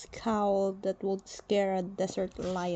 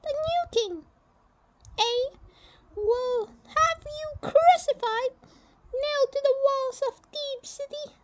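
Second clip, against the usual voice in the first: a much higher, firm general announcing the burial; stern general voice. Acted. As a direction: speak as a proud Egyptian general, high and set. General threatens.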